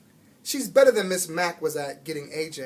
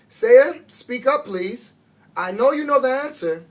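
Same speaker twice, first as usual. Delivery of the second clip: louder, higher insistent teacher call; firm teacher; 7 semitones higher, 9 dB louder than usual. A narrator's reading. Teacher urging a silent pupil, loud and insistent. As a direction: push her to speak up, firmly.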